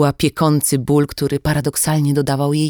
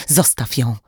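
usual speaking voice

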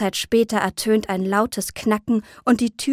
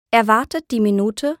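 ordinary delivery

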